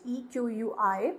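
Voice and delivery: spelling slowly for the class. Shown as a demonstration. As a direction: dictate each letter for notes. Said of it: "एक synonym इसका होता है इक्विवोकेट".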